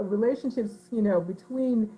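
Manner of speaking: ordinary talk, nothing marked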